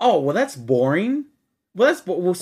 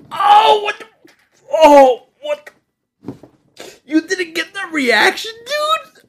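reeling outburst; 14 semitones higher, 9 dB louder than usual. Real, not acted. Reeling from ammonia burn.

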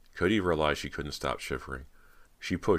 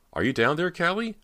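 loud call soaring up high; 7 dB louder than usual. Acted. Girl peering down dark stairs for sister, calling out. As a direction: call down the stairs, voice rising sharply.

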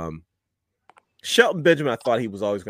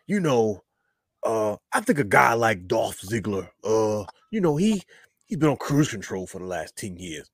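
lightly voiced impression, slow and deliberate at the start; a hint of someone else's voice, measured opening; Booker T; slower than usual. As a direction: do a light take on a famous talker, drawing out the opening. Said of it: "Imma do it in my Booker T voice".

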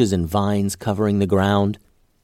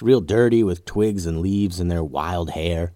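plain reading voice

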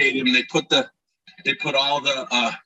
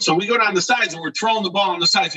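usual speaking voice

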